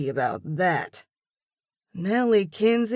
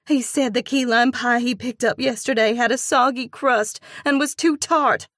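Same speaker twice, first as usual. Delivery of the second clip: higher, louder indignant report; fluttering outrage; 7 semitones higher, 6 dB louder than usual. A narrator's reading. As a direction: relay the complaint with outrage. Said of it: "Her voice flutters with outrage".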